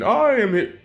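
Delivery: high, strained heroic announcement; All Might. Retold or imitated. Storyteller as hero posting in, high mock-heroic call. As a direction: announce your arrival in a put-on hero voice. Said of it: "you know, I might post in".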